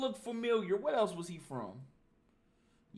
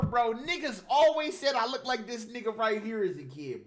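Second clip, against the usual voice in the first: usual speaking voice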